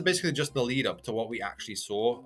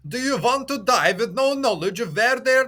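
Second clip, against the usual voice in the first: high, loud theatrical put-on accent; Ivankov accent; 9 semitones higher, 7 dB louder than usual. Ivankov berating captives who want to be saved, hammed-up accent. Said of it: "put on my best Ivankov accent".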